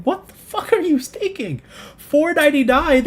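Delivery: high, incredulous outburst; mock disbelief. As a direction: react in disbelief to the price. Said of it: "I'm sitting here saying".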